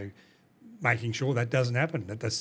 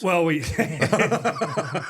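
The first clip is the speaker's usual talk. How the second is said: words broken up by a real laugh, louder and slower; 7 semitones higher, 8 dB louder, much slower than usual. Real, not acted. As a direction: laugh through it, can't get the words out. Laughing at the Boeing joke.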